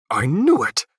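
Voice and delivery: loud sudden bellow. A narrator's reading. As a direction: roar it out over everyone. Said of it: "Olor suddenly bellowed".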